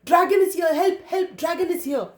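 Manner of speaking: fleeing man shouts to the village, high and panicked; shouting for help. Acted. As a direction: yell for help as you flee. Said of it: "ran away shouting".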